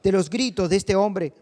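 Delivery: ordinary delivery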